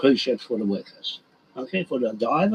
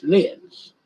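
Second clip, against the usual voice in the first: ordinary delivery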